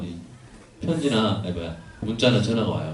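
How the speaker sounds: ordinary talk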